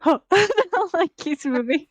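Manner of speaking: ordinary delivery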